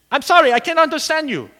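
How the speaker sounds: a lightly voiced confused operator; puzzled operator. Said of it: "He said".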